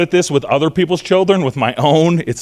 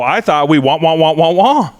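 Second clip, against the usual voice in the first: mock wah-wah lecture climbing higher and louder; exasperated wah wah; 3 semitones higher, 5 dB louder than usual. Frustrated parent repeating the same lecture, as rising mock wah-wah. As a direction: ham up the nagging parent until it's pure noise. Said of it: "And you go".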